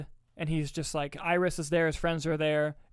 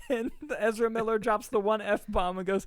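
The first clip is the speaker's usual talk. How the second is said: real laughter creeping into higher-pitched speech; laughing while talking; 5 semitones higher than usual. Real, not acted. Telling co-host the funniest ending, laughing. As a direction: tell it while cracking up.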